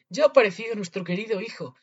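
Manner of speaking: joyful relief. A narrator's reading. Father finds his lost son, exclaiming with relief. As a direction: announce the good news loudly.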